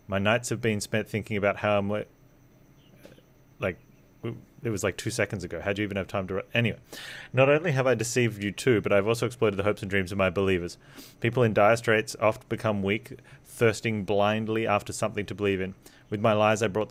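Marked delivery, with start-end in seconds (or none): none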